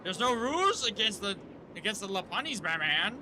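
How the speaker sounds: excited pleading. Real, not acted. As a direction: shout at the screen in a panic. Pleading with game characters.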